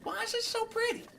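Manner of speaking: very high, gushing wonder; awed squeal. Real, not acted. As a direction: gush in a tiny high squeal. Marvels at a glowing sight.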